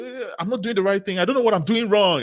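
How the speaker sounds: imitating a frustrated student. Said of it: "a very common thing to say".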